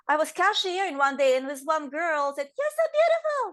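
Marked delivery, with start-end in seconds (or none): 2.6-3.4 put-on quoting a girl's compliment, pitched way up; high, gushing "You're so beautiful."; mock admiring coworker. Girl at the register compliments her, high and gushing.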